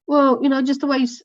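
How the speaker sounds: daughter's excuse. Retold or imitated. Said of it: "She goes".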